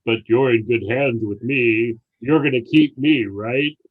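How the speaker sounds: lightly imitated deep ad voice; low insurance spokesman impression, softening at the end; Dennis Haysbert. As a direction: drop low like a TV pitchman. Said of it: "that deep Dennis Haysbert voice".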